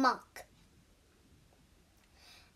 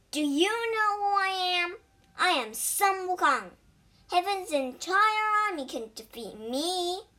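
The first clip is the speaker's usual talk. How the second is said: high, raised-voice boasting; proud boast; 6 semitones higher, 3 dB louder, faster than usual. A narrator's reading. Wukong revealing himself to the demon, boasting proudly. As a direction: announce yourself grandly, pitched up.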